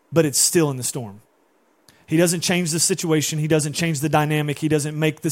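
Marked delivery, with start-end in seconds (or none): none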